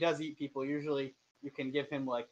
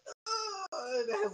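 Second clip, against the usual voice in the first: high cry; 10 semitones higher, slower than usual. Acted. Voicing crushed bug in vomit.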